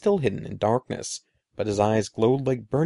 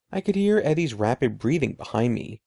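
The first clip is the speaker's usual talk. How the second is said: plain reading voice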